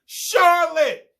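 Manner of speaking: "Charlotte!" yelled high and loud, long falling wail; screamed name. Retold or imitated. Bean screaming "Charlotte!" while spanked, loud high wail. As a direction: cry "Charlotte!" out from the bedroom. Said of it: "sounds like it's being screamed by Bean".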